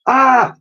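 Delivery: high shrill-free piercing scream; a sudden loud startled scream; lady's scream. A narrator's reading. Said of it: "screamed the lady".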